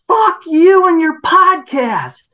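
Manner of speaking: high, loud, slow screamed insult; imitated angry yell. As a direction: scream it down the driveway at him. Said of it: "the next one was".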